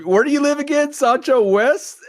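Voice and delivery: high-pitched mock local; lightly voiced small-town local asking.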